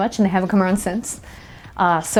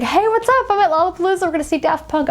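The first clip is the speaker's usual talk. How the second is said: excited greeting; 11 semitones higher than usual. Retold or imitated. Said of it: "And he's just like".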